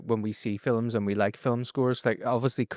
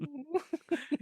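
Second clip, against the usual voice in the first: high giggle; 9 dB quieter than usual. Retold or imitated. Giggle in impression.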